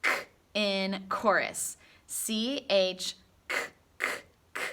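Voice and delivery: crisp k k k; hard k sound said alone. Shown as a demonstration. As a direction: clip out a hard k each time. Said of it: "So say that with me".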